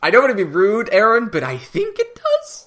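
hammed-up polite protest, rising in pitch; mock incredulity. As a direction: politely object in a high, incredulous voice. Comic reply to baby news.

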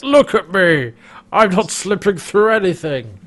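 mock indignant protest. Host plays bloated Rigel refusing Crais, mock indignant. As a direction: protest in an exaggerated character voice.

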